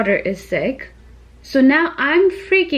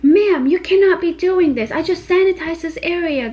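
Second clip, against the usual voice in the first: nurse freaking out; 7 semitones higher than usual. Retold or imitated. Nurse scolding the mother for touching, higher and sharp. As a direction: snap at the careless mom like a strict nurse. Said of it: "this nurse freaks out".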